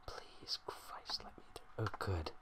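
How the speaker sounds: breathy unvoiced whisper. Reader whispering a quick prayer to get through, whispered. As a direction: hush it right down, barely a breath. Whispered aside while reading.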